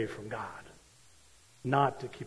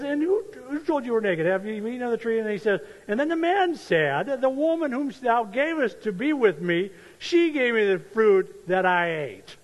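loud, raised reading of Genesis; scripture reading; 9 semitones higher, 7 dB louder than usual. Acted. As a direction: proclaim the verses to the congregation. Reads Genesis aloud.

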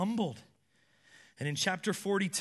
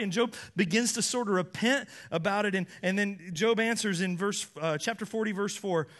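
ordinary talk, nothing marked

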